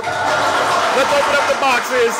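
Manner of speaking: loud, slow, drawn-out announcer cry with a laugh; big showman call. Acted. Host loudly kicks off the call-in segment, drawn out. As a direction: boom it out like opening a game show. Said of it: "that concludes my monologue".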